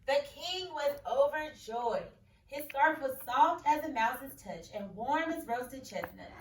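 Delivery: expressive picture-book read-aloud; story reading. A narrator's reading. Teacher reading a picture book to her class.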